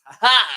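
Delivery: loud, high imitated "ha ha"; retold burst of defiant laughter; mock laugh. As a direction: belt out a short, acted laugh. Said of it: "he's laughing 'cause a".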